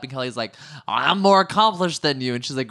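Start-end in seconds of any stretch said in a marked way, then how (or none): 0.9-2.3 mock indignant boast, higher and louder; Kelly Dodd. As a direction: imitate someone blowing up and bragging. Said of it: "that blows Kelly up".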